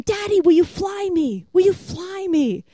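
put-on high, slow little girl's request; a little girl begging her daddy to fly her, high and slow; child asking. Daughter asks dad to fly her.

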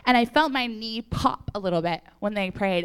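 ordinary talk, nothing marked

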